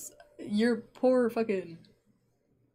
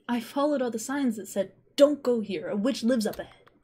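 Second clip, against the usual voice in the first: put-on boy character voice; 4 semitones higher, 3 dB louder than usual. Scarecrow boy voice.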